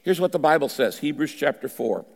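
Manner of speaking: ordinary talk, nothing marked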